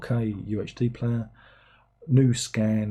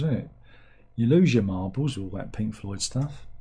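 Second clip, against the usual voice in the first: ordinary talk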